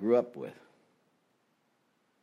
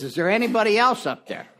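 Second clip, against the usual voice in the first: much higher, louder punchline; doubtful drunk; 12 semitones higher, 6 dB louder than usual. Acted. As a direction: shout up, hoping for a second opinion. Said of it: "he says".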